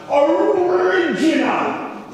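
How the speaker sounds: high, drawn-out Jamaican shout; loud Jamaican accent, voice pushed high. Shown as a demonstration. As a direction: stretch it out in a strong Jamaican accent. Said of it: "saying it in a straight Jamaican accent".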